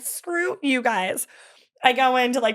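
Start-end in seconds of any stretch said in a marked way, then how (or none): 0.0-1.3 slow, higher mock snarl; daughter's hammed-up reply to laughing dad; mock-defiant. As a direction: drag it out in fake defiance. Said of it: "I'm like".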